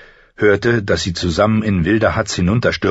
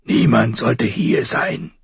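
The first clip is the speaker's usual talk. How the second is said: slow, higher sullen complaint; sour murmur; 5 semitones higher, slower than usual. A narrator's reading. Intruder annoyed that anyone is in the room, sour murmur. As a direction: grumble it, stretching each word. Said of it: "murmelte er sauertöpfisch".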